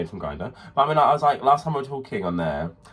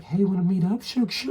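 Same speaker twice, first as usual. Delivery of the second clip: lightly put-on higher chummy voice; mock-friendly invite; 8 semitones higher than usual. Pretending to invite an old teacher out, playfully high. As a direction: lift it high, playing overfamiliar.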